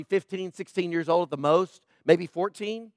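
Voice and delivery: usual speaking voice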